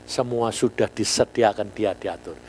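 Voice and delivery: ordinary talk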